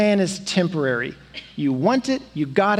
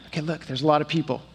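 hushed aside; 5 semitones lower, 5 dB quieter, much faster than usual. Retold or imitated. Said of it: "And he's like".

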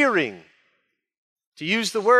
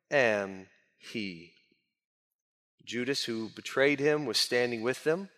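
hushed scripture reading; 8 semitones lower, 7 dB quieter than usual. Acted. Preacher reading John's arrest scene aloud, low and hushed. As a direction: lower and much softer, reading the passage reverently.

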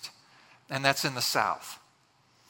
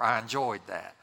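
put-on Southern accent; 5 semitones lower than usual. Preacher mocking a listener's polite compliment, Southern accent. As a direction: lower mock Southern voice. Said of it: "a little Southern accent".

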